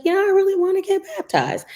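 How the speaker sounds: imitating a young boy, high and quick. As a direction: voice the ten-year-old eagerly. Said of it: "he was like".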